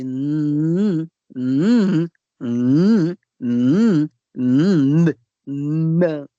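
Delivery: long hesitant nasal hum; unsure pupil's nnn. Acted. Pupil stumped by a sum, hesitant drawn-out nnn. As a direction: stall on a nasal nnn, not knowing the answer. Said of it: "O som como de alguém que não sabe".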